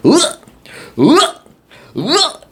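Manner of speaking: high-pitched mimicked "ugh", in short bursts; imitated repeated grunt. Dad repeatedly swinging a rock at the crab, high mimicked grunts. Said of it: "he just starts doing this over and over".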